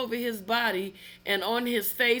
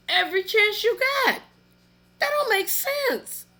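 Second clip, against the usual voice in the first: outraged disbelief; 11 semitones higher, 4 dB louder than usual. Real, not acted. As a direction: voice climbs up in disbelief. Outraged at the abusers.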